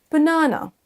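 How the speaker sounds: British BANANA, light buh then high NAH; prononciation britannique légère. Shown as a demonstration. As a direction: keep the ends of BANANA light, middle AH. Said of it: "un petit peu plus léger". (BANANA britannique, début léger)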